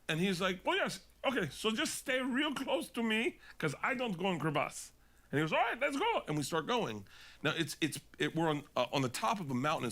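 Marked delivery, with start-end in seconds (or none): 0.6-4.9 put-on high, broken-English Swiss accent; mock Swiss mountain guide, pitched up. Swiss guide telling client to stay close, high Swiss accent. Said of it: "that's the Switzerland guy". 5.5-6.2 put-on high, broken-English Swiss accent; mock Swiss mountain guide, pitched up. Swiss guide telling client to stay close, high Swiss accent. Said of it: "that's the Switzerland guy".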